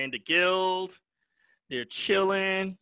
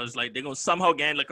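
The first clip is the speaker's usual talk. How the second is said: ordinary talk, nothing marked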